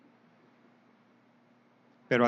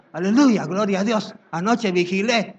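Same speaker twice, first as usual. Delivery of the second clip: loud, bright mock praise; mock hallelujah; 4 semitones higher, 6 dB louder than usual. Pastor imitating a boastful believer after the vigil, loud. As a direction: cheer it like a smug believer.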